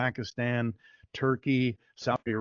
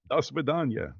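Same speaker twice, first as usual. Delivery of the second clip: put-on light playful Russian accent; 4 semitones higher than usual. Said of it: "I do good Russian accent".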